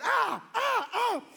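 high-pitched wordless quarrel yells; imitated back-and-forth shrieks; volley of hammed-up high yells; mock yelling. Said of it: "they're yelling back and forth".

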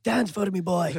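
put-on high, teasing Australian-accent demand; bossing him around like a showman; mocking command. Teasing friend to perform.

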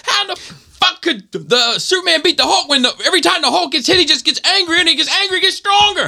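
high, incredulous imitation rant; yelling drill instructor. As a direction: build the argument in his high, accented bark. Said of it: "He's like".

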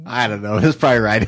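usual speaking voice

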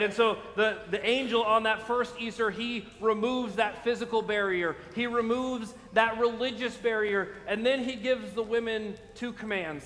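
ordinary talk

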